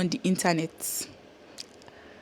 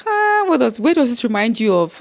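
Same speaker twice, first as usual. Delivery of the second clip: musing question; 4 semitones higher, 5 dB louder than usual. Real, not acted. Asking herself what the country recalls.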